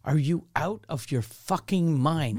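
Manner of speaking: incredulous outburst, pitch leaping up; retold anger. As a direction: re-enact being outraged at the salesman. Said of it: "I was so angry".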